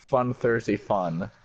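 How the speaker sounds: ordinary talk, nothing marked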